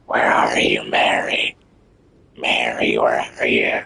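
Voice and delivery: low hushed possessed whisper. Retold or imitated. Gamer imitating the possessed-sounding character. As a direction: call out like a haunted soul. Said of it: "he needs a damn exorcist".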